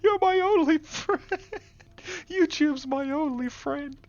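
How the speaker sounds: hammed-up wail. Lamenting to YouTube as the only friend, slow high wail. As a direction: stretch it out like a lonely comic lament. Joking plea to viewers.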